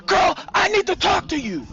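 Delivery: high, urgent yell. A narrator's reading. Sandra outside while Nisi locks up, yelling. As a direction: holler it through the locked door. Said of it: "Sandra keeps yelling out to her".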